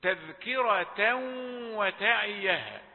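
"تذكرة وتعيها" slowly, n melting into nasal w; merged tanween. Shown as a demonstration. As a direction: hold a hum on the w of "وتعيها". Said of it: "هذا تنوين أدغم في الواو". (إدغام بغنة في واو "وتعيها")

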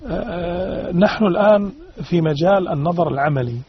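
usual speaking voice

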